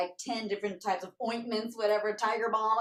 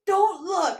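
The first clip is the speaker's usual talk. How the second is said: embarrassed yelp; 10 semitones higher, 11 dB louder than usual. Retold or imitated. Naked friend covering himself.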